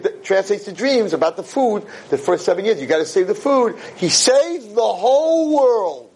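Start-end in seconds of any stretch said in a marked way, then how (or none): 4.0-6.1 triumphant proclaiming. Acted. Rousing climax of the sermon.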